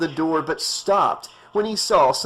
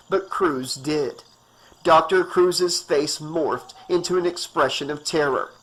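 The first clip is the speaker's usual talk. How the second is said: ordinary delivery, nothing marked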